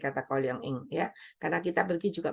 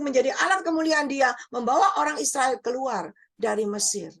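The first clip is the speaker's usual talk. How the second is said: raised, ringing sermon voice with big shouted peaks; fiery preaching; 6 semitones higher than usual. Acted. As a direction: preach it to the whole hall, building in force. Preaching to the congregation.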